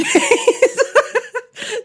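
giggling burst of laughter; high laugh. Real, not acted. Laughing at own story.

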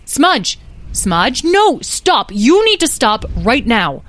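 loud, urgent motherly shout; alarmed command. Acted. Beatrice calls after Smudge racing ahead, alarmed. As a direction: order a runaway child to halt.

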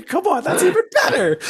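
laughing. Real, not acted. Laughing at the new name.

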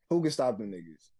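ordinary delivery

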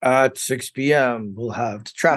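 crisp evening news anchor read; newscaster voice. Pretend. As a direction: announce it like the TV news. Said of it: "he kind of sounds like a newscaster".

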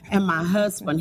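ordinary talk

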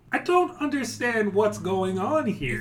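a confused goblin questioning the player; baffled goblin boss. Acted.

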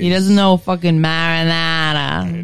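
put-on a Brit faking a New Jersey accent; Brit doing New Jersey. Mocking English actors who try to sound like New Jersey guys, in a bad Jersey accent. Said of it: "you sound like my friend from Australia doing a New Jersey accent".

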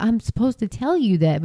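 ordinary delivery

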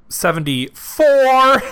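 high, loud, stretched-out last word; mock golf-course shout on "four"; golfer's "fore" call. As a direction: holler the end like a golf warning. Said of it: "Like we're playing golf".

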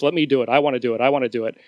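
usual speaking voice

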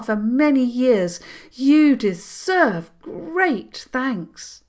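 high, loud, slow regal princess; a freed princess, grand and grateful; proud princess voice. Acted.

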